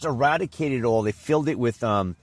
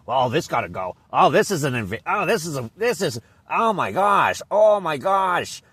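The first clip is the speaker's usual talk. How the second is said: mock busybody; 6 semitones higher, 4 dB louder than usual. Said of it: "people who've come in there like".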